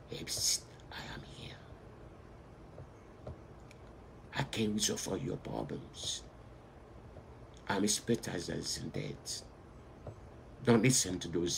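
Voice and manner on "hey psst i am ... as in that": soft breathy whisper of a hidden helper. Pretend. Preacher voicing the small voice to believers, whispering. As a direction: lean in and whisper the promise. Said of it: "listen to the whisper voice".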